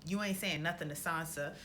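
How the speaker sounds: ordinary talk, nothing marked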